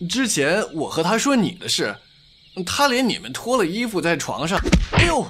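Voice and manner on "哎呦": sudden high yelp of pain. Acted. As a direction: cry out as if struck. Friend gets hit.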